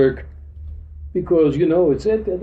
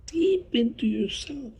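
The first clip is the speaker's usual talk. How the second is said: higher, softer echo; 7 semitones higher, 8 dB quieter than usual. Shown as a demonstration. Teacher urging the audience to look inward, high and hushed. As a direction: lift "deep into yourself" up high and soft. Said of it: "once you looked all the way deep into yourself".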